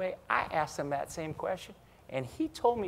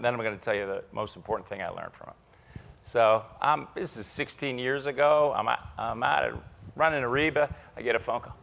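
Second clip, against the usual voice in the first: ordinary talk, nothing marked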